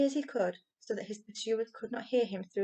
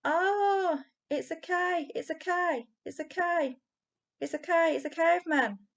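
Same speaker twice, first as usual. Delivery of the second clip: very high panicked screaming; screaming in terror; 9 semitones higher, 5 dB louder than usual. A narrator's reading. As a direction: flee shrieking at the sight of a caveman. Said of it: "sobbing and screaming".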